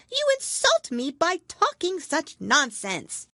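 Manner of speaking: high, loud huffy squeak; indignant tiny mouse; offended mouse. A narrator's reading. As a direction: bristle with wounded pride.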